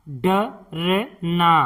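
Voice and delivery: डरना drawn out slowly as ड-र-ना; slow syllable-by-syllable. Shown as a demonstration. Teacher sounding out डरना for pupils, slowly. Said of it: "इस तरह से".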